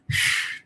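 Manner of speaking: long calming exhale, breathed out audibly; demo sigh. Shown as a demonstration. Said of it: "take a deep breath".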